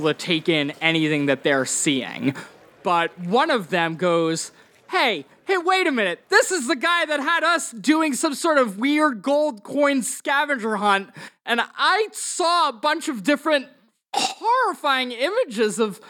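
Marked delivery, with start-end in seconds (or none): 4.9-16.0 high-pitched accusing voice; indignant carnival guest. Acted. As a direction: call out the culprit in a high, upset voice. Game master plays an upset guest.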